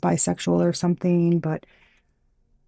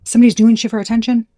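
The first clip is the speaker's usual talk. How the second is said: higher, louder incredulous question; indignant disbelief; 5 semitones higher, 6 dB louder, faster than usual. Real, not acted. Vlogger mocking an attention seeker, indignant. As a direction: ask it in outraged disbelief.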